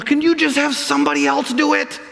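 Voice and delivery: put-on high whining kid; whiny child. Moses acting like a child toward God, whiny. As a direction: whine like a child dodging chores. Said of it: "starts acting like a child".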